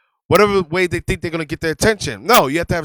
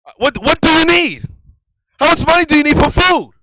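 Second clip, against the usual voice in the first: higher, louder annoyed outburst; husband cutting off a long story, loudly annoyed; exasperated retort; 9 semitones higher, 6 dB louder than usual. Retold or imitated. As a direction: fire it back at the wife, fed up. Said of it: "I said".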